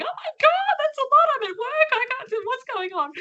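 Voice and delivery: high-pitched mock panic, rushed complaints; panicked students. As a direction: squeal it like overwhelmed people freaking out. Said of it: "all these people going".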